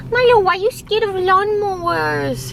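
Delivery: high sing-song voice for the dog; pet talk. Real, not acted. Owner asking her frightened dog, high cooing pet voice.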